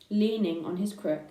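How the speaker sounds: ordinary delivery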